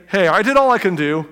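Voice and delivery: loud, breezy self-excuse; hammed-up easy excuse; mock cop-out. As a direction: toss it off like you're off the hook. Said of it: "It'd be easy for me to say".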